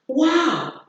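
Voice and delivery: high, long amazed wow; surprised exclamation. Shown as a demonstration. Teacher repeats an exclamation example for class, surprised. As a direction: let the surprise soar and fall. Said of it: "sentences expressing surprise".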